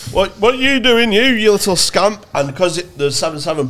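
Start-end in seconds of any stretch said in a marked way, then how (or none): 0.1-2.3 higher, louder mock-stern policeman; scolding copper. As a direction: tell off the lad like a pantomime bobby. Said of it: "and he went".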